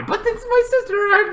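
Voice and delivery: high, whiny pleading; lightly mocked plea. Brother refusing to let sister die, high whiny plea. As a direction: whine it like a desperate brother.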